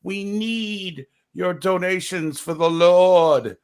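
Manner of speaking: slow, drawn-out preacher parody; mock televangelist. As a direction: play a pleading TV preacher, words stretched. Mock preacher begging for donations.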